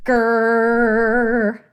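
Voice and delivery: loud, sustained growling grind; long loud "grrr"; grinding noise. A narrator's reading. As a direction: imitate a machine grinding up. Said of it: "A loud grinding up sound".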